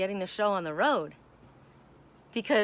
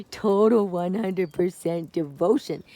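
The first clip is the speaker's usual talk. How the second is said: cat voice again; 2 semitones lower, 5 dB louder, slower than usual. Acted. Repeats as cat.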